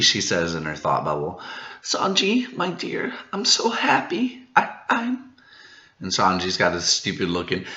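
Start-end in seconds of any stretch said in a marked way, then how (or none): 1.8-5.3 put-on high, soft, dreamy girl voice; lovestruck Pudding. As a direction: swoon over Sanji, hushed and high. Pudding gushing to Sanji.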